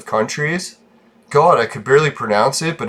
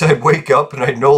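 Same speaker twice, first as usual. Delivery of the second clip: usual speaking voice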